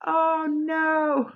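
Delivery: high, dismayed little cry; a playful gasp of parental dismay. Retold or imitated. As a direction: react in mock horror at the kid. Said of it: "I was like".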